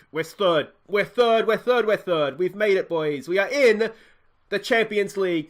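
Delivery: loud, joyful celebration; jubilant. Real, not acted. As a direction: cheer the result. Celebrating qualification.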